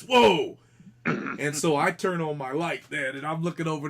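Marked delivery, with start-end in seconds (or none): 0.0-0.6 loud high whoa, falling; imitated startled yell. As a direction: show how he yelled it, loud and high. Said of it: "like this".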